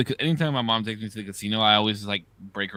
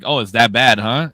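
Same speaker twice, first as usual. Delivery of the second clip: teasing; 10 dB louder than usual. Retold or imitated. Retold teasing to dealer.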